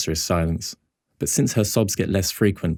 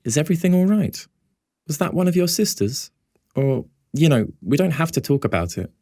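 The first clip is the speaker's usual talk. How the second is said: put-on higher, concerned girl's voice; worried, serious; 10 semitones higher than usual. A narrator's reading. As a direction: gently check if he's all right. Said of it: "a worried and more serious tone".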